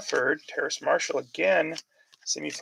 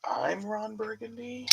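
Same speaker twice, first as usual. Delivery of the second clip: slow, higher, soft anchorman parody; a light hammed-up anchorman line; Ron Burgundy quote; 7 semitones higher, 10 dB quieter, slower than usual. Joking that the coach sounded like an anchorman, slow soft parody. Movie quote after Duval question.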